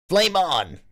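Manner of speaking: high, ringing hero battle cry; superhero shout. Pretend. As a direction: shout it like a comic-book hero. Superhero catchphrase after word flame.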